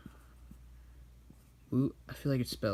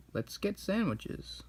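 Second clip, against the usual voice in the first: much higher, slow character voice; higher comic master voice; higher voiced master; 9 semitones higher, 4 dB quieter, slower than usual. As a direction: announce sandwiches in a funny voice. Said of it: "He's like".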